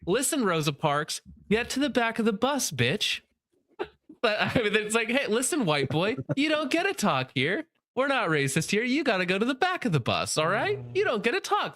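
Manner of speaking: high, mocking activist voice pitched well up; hammed-up scolding activist voice; mock woke activist. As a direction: sneer it like a preachy online activist. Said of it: "do people talk like this unironically".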